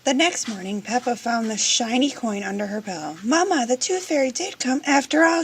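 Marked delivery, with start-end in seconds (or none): 3.2-5.4 high, louder delighted cry; excited shout. Acted. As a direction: shout the news with joy. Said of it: "she shouted".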